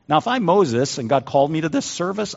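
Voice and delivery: normal talking voice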